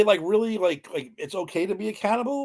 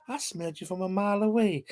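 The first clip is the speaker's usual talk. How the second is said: creepy Sully impression; 2 semitones higher, 5 dB quieter than usual. Imitating the creepy guy in the movie, creepy voice. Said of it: "he talks like this".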